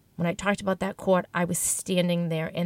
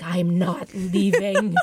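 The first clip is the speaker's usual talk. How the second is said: laughing, louder and slow, digging in; stubborn shopper voice; 4 dB louder, much slower than usual. Retold or imitated. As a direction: refuse to budge, laughing at yourself. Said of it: "the shopper in me ... was like".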